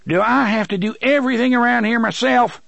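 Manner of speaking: quick, high exasperated yell; exasperated shout. A narrator's reading. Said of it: "shouted, more exasperated than angry".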